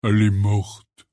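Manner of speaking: gruff, grunted statement; growled. A narrator's reading. Hagrid tells of his mother, growling. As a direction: growl it, heavily acted giant. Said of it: "grogna Hagrid".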